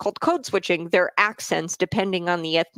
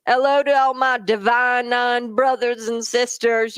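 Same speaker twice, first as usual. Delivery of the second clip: Kamala Harris; 5 semitones higher than usual. Retold or imitated.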